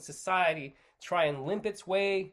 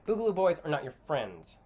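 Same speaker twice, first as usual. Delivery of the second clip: usual speaking voice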